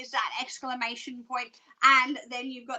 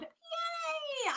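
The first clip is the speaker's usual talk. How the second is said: high-pitched squeal of joy; delighted cheer; 14 semitones higher, 7 dB quieter than usual. Real, not acted. Reader cheering to Karen about the dragon card.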